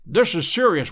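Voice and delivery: a sudden loud cry, stress on This; cried out. A narrator's reading. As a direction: call out the alarm to Watson. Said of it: "he cried".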